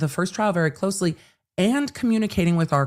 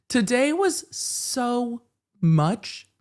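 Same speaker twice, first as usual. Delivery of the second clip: drawn-out weary; 7 semitones higher, much slower than usual. Real, not acted. Exhausted drawn-out sigh.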